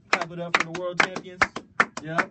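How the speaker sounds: loud crowd-pumping call; hype shout. Real, not acted. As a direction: shout it up to the room. Hyping crowd to clap.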